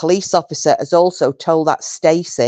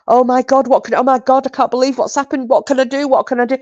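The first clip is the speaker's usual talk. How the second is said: panicked normal person; 7 semitones higher than usual. Retold or imitated. Imagined decent person reacting to the injured dog, mock panic. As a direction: play a flustered owner in shock. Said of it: "A normal person would have been".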